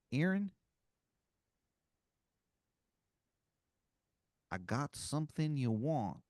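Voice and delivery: lightly voiced Philly wizard leaning in; awful Philadelphia accent. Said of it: "his accent is just awful".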